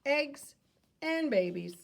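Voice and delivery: ordinary talk, nothing marked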